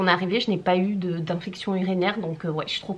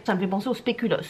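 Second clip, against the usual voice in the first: usual speaking voice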